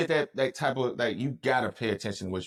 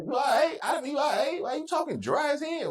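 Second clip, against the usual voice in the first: high, puzzled mock concern; incredulous mock concern. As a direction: ask like a baffled friend checking in. Mock asking her what's wrong.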